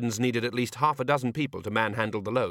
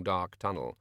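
ordinary delivery, nothing marked

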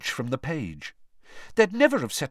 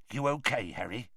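muttering; 7 dB quieter than usual. A narrator's reading. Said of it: "Hagrid muttered".

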